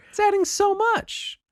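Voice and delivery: very high, slow excited protest; high-pitched fan outrage; incredulous squeal. Real, not acted. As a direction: squeak it out in disbelief. Protesting co-host's complaint about price.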